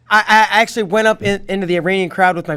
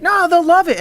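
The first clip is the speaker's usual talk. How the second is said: upbeat photographer; 12 semitones higher, 3 dB louder than usual. Retold or imitated. Photographer reassuring about Iranian crowd.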